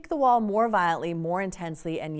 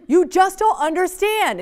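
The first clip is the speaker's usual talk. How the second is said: put-on loud teen protest; 8 semitones higher, 9 dB louder than usual. Teen complaining to parent.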